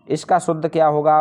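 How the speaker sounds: usual speaking voice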